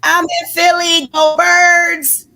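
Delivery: high, very loud sports-fan holler; real exuberant fan shout; loud hometown cheer. Real, not acted. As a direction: whoop it out for your team, drawing out the last cheer.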